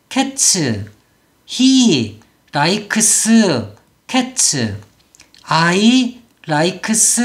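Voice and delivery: I like cats as "a-i la-i-keu kae-cheu", syllable by syllable; slow halting reading, likes as "la-i-keu-seu", cats as "kae-cheu"; beginner Koreanized reading. Shown as a demonstration. Teacher re-enacts a first-time English learner, Koreanized and slow. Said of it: "그러면 재현해 보겠습니다".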